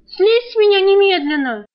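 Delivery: high, loud shriek right in his ear; shrieking order. Acted. Girl shrieking at Alk to get off her. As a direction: scream it like you're being slaughtered. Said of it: "Завизжала в самое ухо, как резаная".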